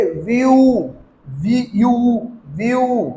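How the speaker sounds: व्यू drawn out, split into वी, यू; sounded out slowly. Shown as a demonstration. Teacher showing the यू sound, stretched and split. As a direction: hold व्यू long, split it, then blend. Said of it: "यू pronounce करते हैं".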